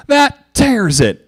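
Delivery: high, slow, loud furious yell; angry yell. A narrator's reading. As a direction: bark it in fury. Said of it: "Applejack yelled".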